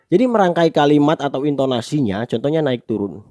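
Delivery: normal talking voice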